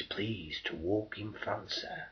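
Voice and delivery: slow, hushed, unvoiced plea; soft mock-polite whisper; fawning whisper. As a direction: whisper it with sly deference. Sudden politeness when danger comes.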